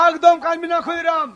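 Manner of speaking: loud, firm command to stop crying; loud consoling. Acted. Friend of the dead father tells Khudiram not to cry, loud consoling.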